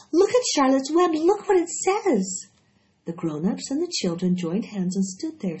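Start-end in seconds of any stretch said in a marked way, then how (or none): 0.1-2.5 put-on much higher, louder girl's cry; excited cry. A narrator's reading. Fern calling the grown-ups to the web, excited. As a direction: point and shout with delight. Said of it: "cried Fern".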